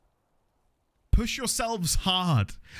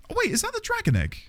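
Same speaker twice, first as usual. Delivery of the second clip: surprised; faster than usual. Real, not acted. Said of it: "sudden spotting the egg".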